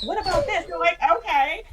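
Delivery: high, loud imitated bawling; mock bawling. Two friends crying over closet, mock bawling. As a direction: squeak it out in mock tears. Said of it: "the two of us are bawling".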